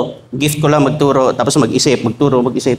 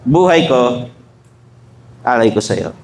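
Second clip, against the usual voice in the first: tender vow to wife; 4 semitones lower, slower than usual. Retold or imitated. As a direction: offer your life gently. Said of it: "Sabihin niyo sa misis niyo".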